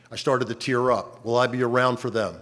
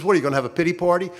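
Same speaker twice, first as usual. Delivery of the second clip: quick, higher teasing jab; mocking nurse; 6 semitones higher, much faster than usual. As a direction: needle him about his self-pity. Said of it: "She says".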